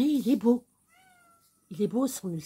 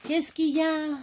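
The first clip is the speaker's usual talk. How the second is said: pet talk; 5 semitones higher, 4 dB louder than usual. Real, not acted. Talking to her pet.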